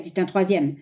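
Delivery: ordinary delivery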